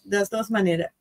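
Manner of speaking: ordinary talk, nothing marked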